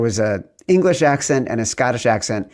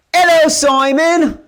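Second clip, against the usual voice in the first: put-on very high, drawn-out English-accent holler; yelled mock British accent; 5 dB louder, slower than usual. Host greeting his co-host Simon, yelled fake British accent. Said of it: "Well, I yell".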